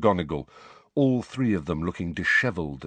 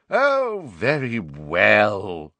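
reluctant; 4 dB louder, much slower than usual. A narrator's reading. Giving in reluctantly.

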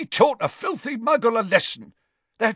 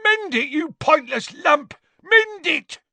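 very high, loud enraged scream; 5 dB louder than usual. Acted. Father ordering his daughter to fix the pot, screaming. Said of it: "Gaunt screamed".

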